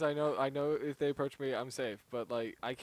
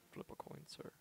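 menacing movie villain; 19 dB quieter than usual. Retold or imitated. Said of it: "your impression of... the bad guy from No Country".

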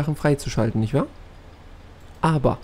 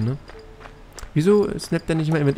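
ordinary talk

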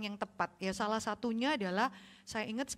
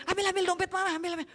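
put-on urgent child whisper-plotting; 8 semitones higher, faster than usual. Child urging to grab wallet.

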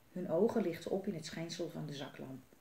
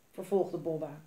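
ordinary delivery

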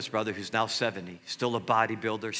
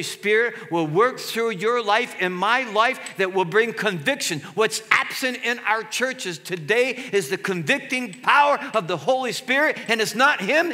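fervent preaching; 10 semitones higher, 6 dB louder than usual. Real, not acted. Pastor urging the church to pray for conviction, preaching hard. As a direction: drive it home to the congregation.

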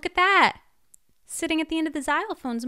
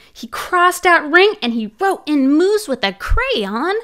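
usual speaking voice

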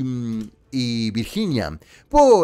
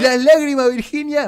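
excited shout; 12 semitones higher, 6 dB louder than usual. Real, not acted. Gushing over crying contestant.

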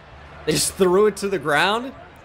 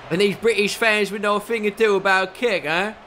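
put-on deliberately rough British accent, higher than usual; bad English accent; 5 semitones higher than usual. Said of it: "I broke out some real bad English accents".

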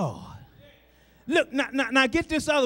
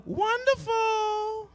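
high, drawn-out mock holler; a sister's high slow holler of wonderful from the pews; 11 semitones higher, much slower than usual. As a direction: stretch it out like a church sister calling out. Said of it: "she would holler".

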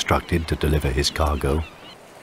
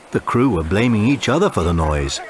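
ordinary delivery

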